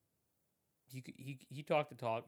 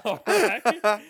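loud high laugh through words; can't stop laughing; laughing hard; 11 semitones higher, 16 dB louder than usual. Real, not acted. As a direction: burst out laughing.

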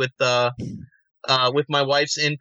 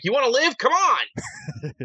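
a much higher, cracking frantic plea; Nick Stahl twist; 10 semitones higher, slower than usual. Retold or imitated. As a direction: yelp it high and desperate. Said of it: "how Nick Stahl twisted it".

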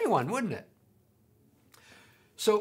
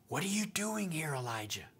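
much softer, hushed question; gentle whisper; 3 semitones lower, 11 dB quieter than usual. Acted. God's voice asking Elijah in the cave, gently whispered. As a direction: ask it gently, barely above a breath. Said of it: "a gentle whisper".

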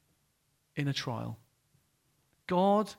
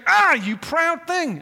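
playful rebuke; 10 semitones higher, 8 dB louder, slower than usual. Retold or imitated. God voiced lovingly convicting.

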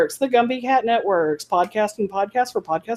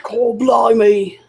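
slow, higher, hammed-up British accent on "Cool, blimey"; bad British accent; 4 semitones higher, 4 dB louder, much slower than usual. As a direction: stretch "blimey" out like a clumsy Brit. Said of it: "than my British accent".

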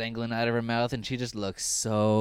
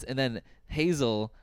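ordinary talk, nothing marked

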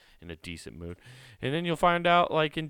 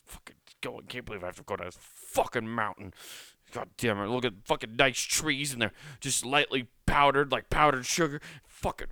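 grumbling pretend-angry skier, building louder; mock furious skier; 4 semitones higher, 5 dB louder than usual. Imagined skier cursing the mountain while furiously skiing. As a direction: grouse your way down the slope. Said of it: "skis just fucking furious".

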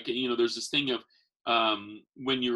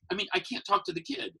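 ordinary talk, nothing marked

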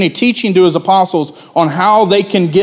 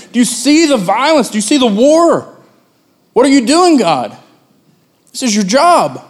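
high, raised voice crying out at God; impassioned protest; 7 semitones higher than usual. Acted. Habakkuk complaining to God in a vision, high indignant cry. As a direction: demand an answer, indignant. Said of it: "pointing to earth saying".

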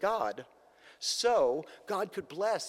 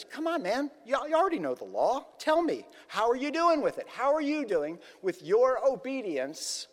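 higher, louder, prodding questions; coaxing challenge; 7 semitones higher, 4 dB louder than usual. Retold or imitated. Jesus challenging the young man on the law, coaxing challenge. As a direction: press him with an incredulous come-on. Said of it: "Jesus kind of said to him".

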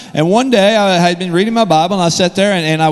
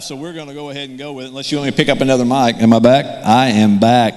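ordinary talk, nothing marked

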